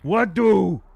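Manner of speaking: high, loud, blunt question; simple-minded helper. Acted. Sharn arrives and asks for orders, loud simple voice. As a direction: ask eagerly in broken speech.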